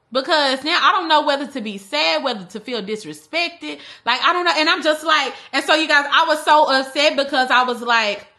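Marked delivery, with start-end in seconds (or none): none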